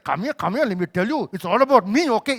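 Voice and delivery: bossy command, rising to a loud finish; imitating a domineering boss; mock dictator. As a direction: order him over, then hit ME hard. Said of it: "It wasn't a dictator".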